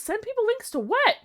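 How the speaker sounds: high, confused rising question; baffled squeak; puzzled. Real, not acted. Puzzled reading.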